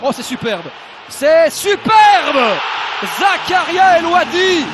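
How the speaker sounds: stretched, loud goal shout; long goal yell. Real, not acted. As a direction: drag out superbe in a roar. Goal call, stretched superbe. (long cri de but)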